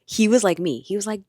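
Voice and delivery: ordinary talk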